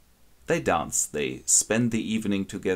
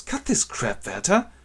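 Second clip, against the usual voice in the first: sharp, higher scolding; annoyed rebuke; 9 semitones higher than usual. Acted. Albert scolds Werther for the pistol joke, sharply and higher. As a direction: snap at him to stop. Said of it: "and Albert goes".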